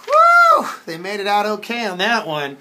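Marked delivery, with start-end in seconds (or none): 0.1-0.7 very high, loud whoop of relief; relieved whew. Pretend. Reader after they made it out okay, relieved whew. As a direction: let out a big play-acted whew.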